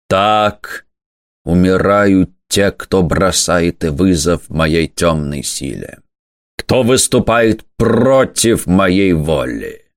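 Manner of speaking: low, slow demonic gloat; a hint of a gloating demon lord; menacing demon voice. Demon prince gloats over the fallen. (зловещий голос демона, медленно и низко)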